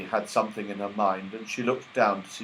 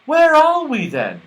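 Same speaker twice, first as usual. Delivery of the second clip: alarmed; 8 dB louder than usual. Acted. Beth alarmed, asking.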